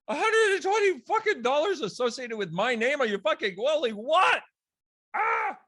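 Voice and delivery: blurting out the shock; shocked shout. Retold or imitated.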